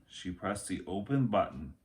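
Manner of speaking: ordinary delivery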